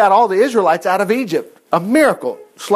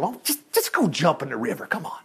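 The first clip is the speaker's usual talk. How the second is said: whispered, much softer plea; hushed coaxing; 3 semitones lower, 9 dB quieter than usual. Acted. Officers quietly urging their proud general, whispered. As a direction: lean in and whisper him toward the river.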